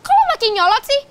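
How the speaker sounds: loud, high, indignant; irritated challenge. Acted. Girl confronting her snappish friend, irritated and loud. Friends quarrelling over boys.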